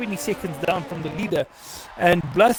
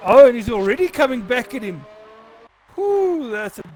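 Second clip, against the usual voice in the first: excited; 5 semitones higher, 4 dB louder than usual. Real, not acted. Car attacking, live call.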